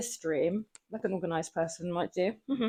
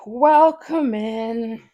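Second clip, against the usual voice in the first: loud, drawn-out welcome; stretched greeting, higher and much louder; calling out; 4 semitones higher, 12 dB louder, much slower than usual. Real, not acted. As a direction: call out a big, slow greeting. Streamer calls out greeting to newcomer.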